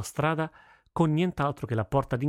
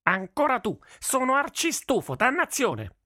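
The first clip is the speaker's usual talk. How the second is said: very high-pitched furious outburst; angry, strained high yelling; infuriated voice. A narrator's reading. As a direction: explode in exasperation at a repeat offender. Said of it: "una voce infuriata".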